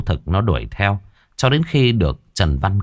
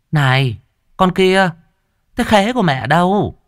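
sugary false calling to the stepdaughter; stepmother's fake sweetness; 10 semitones higher than usual. Stepmother calls the girl, falsely sweet. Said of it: "Ả đi ra giả lả hỏi".

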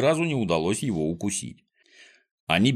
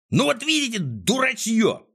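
exasperated outburst in a much higher voice; Клопс yelling; 12 semitones higher than usual. A narrator's reading. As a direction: yell it high at a bunch of fools. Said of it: "заорал Клопс".

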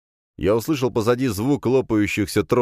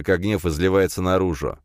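plain reading voice

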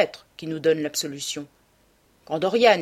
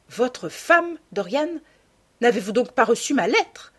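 higher, startled question; perplexed surprise; 6 semitones higher, 5 dB louder than usual. A narrator's reading. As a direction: disbelief rising in the voice. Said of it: "avec un étonnement perplexe". (étonnement perplexe de lord Henry)

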